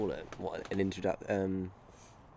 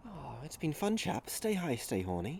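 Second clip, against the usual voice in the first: put-on Welsh valleys accent; 6 semitones higher, 7 dB quieter than usual. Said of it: "What about you, Welsh?".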